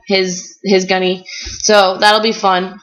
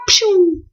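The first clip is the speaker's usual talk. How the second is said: whoosh sound; 11 semitones higher than usual. Retold or imitated. Friends zooming off down slope.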